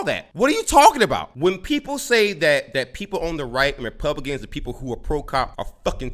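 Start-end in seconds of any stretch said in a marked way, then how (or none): 0.3-1.3 incredulous. Real, not acted. Incredulous at teacher's claim.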